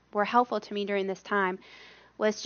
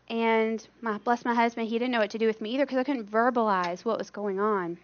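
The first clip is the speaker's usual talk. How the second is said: normal talking voice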